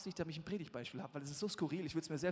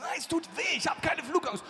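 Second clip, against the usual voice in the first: loud, high insistence through pain; pained protest; 10 semitones higher, 8 dB louder than usual. Retold or imitated. Preacher refusing the neighbour's schnapps, loud and pained. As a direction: shout him down, it hurts. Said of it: "Ich sag".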